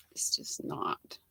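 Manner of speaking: breathy whisper under the breath; a quick whispered complaint; whispered aside. Real, not acted. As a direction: drop to a whisper for a private grumble. Muttered aside about bad lighting.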